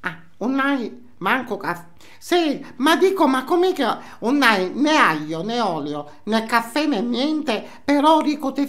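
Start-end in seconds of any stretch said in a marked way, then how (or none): none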